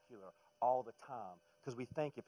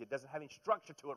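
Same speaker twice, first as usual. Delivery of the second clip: ordinary talk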